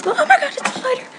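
shrieking high in fright; panicked scream. Real, not acted. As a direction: scream at a sudden spider. Spider suddenly appears.

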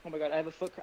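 ordinary delivery

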